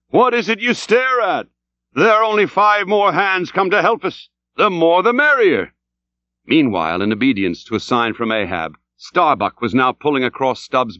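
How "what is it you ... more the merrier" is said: high, raised coaxing call; jolly the oarsmen along from the stern; urging the crew. A narrator's reading. Stubb talks to his staring crew, high and urging.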